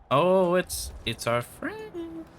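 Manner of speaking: slow falsetto "our friend"; high sing-song coo. Pretend. Spotting target, cooing.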